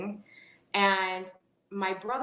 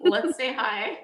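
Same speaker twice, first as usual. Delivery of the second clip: playful higher, louder quote; mock-eager little greeting; imitating a friendly brother; 4 semitones higher, 6 dB louder than usual. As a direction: voice a keen kid, lightly. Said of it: "my brother".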